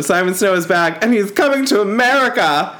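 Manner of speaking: high announcer voice; silly announcer voice. Pretend. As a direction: announce it like a trailer. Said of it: "when I talk in that voice".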